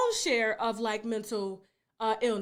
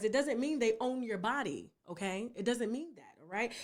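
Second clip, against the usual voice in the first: softly, in a lower voice; quiet, low-pitched counsel; doctor gently explaining; 6 semitones lower, 9 dB quieter than usual. Retold or imitated. Doctor explains consent to Tasha, gently. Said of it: "And she said".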